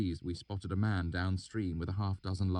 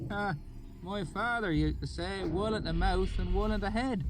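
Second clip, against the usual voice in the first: high rustic country voice; oily backwoods dialect; thick valley accent. A narrator's reading. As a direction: swineherd musing in a thick rural accent. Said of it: "his accent so thick".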